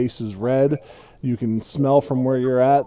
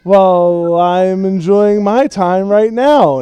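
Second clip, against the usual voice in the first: put-on loud, higher, drawn-out drunken boast; tipsy hobbit declaration; 8 semitones higher, 11 dB louder, slower than usual. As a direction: proclaim it merrily, slow and loud. Tipsy Frodo declares his revelry.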